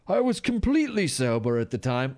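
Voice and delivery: put-on upper-crust English voice; upper-crust British accent. Host as teen raver claiming sobriety, mock upper-crust accent. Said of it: "like, an upper crust, uh, type of accent".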